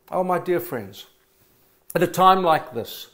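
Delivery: ordinary talk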